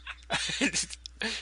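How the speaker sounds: soft airy giggle; quiet breathy chuckle; breathy laugh. Real, not acted. Laugh heard.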